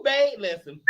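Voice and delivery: ordinary delivery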